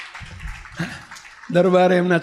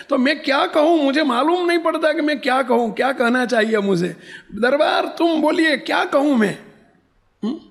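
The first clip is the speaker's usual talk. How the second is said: high, urgent appeal; flustered pleading; 8 semitones higher than usual. Retold or imitated. Old woman asking darbar what to say, flustered. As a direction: plead for an answer in a raised pitch.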